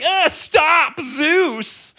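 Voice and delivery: high strained yell at a dragging dog; mock yell at dog. Owner yelling at a big dog pulling him. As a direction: shout after the runaway dog.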